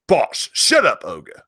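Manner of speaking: high mock British scolding; hammed-up British telling off; British accent. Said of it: "I can hear the British right now".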